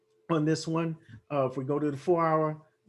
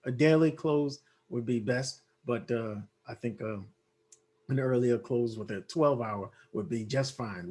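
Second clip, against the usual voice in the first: ordinary talk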